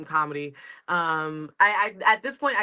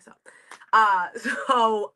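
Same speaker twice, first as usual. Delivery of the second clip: ordinary talk, nothing marked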